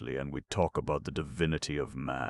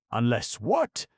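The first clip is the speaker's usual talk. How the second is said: higher, louder eager "what?"; eager question; 8 semitones higher, 5 dB louder than usual. Acted. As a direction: press him eagerly for the answer. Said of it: "eagerly inquired Arthur".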